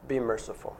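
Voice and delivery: preacher urging his congregation, soft and earnest; softly urging. Shown as a demonstration. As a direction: drop the voice low on be merciful, gently insistent. Repeats the command softer.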